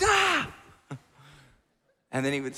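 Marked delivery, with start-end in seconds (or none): 0.0-0.5 loud high yelp of pain as the joint snaps back; sharp scream of pain. Retold or imitated. Friend's shoulder pops back in, high pained scream. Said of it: "he'd be like".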